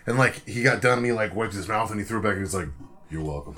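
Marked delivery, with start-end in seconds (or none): 3.1-3.6 low, quiet mock nonchalance; retold cool sign-off. Rock star after the stunt, understated cool. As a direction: lightly imitate a star's offhand low sign-off.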